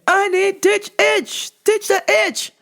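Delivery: a mock desperate customer begging for a stronger cream; high pleading customer. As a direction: squeak out the demand like a desperate, out-of-it customer.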